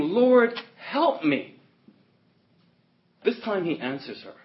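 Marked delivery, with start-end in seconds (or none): 0.0-1.6 high, loud cry for help; acted appeal, voice thrown up high; imitated plea. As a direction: cry out as the desperate woman. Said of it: "she came and worshipped him, saying".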